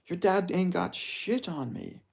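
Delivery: slow, high put-on voice; Strickland. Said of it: "I was doing Strickland's way".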